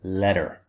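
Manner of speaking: "letter" said like "ledder"; "letter" in American style, d sound in the middle; American flapped T. Shown as a demonstration. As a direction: turn the t of "letter" into a d. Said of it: "In American English, this would be".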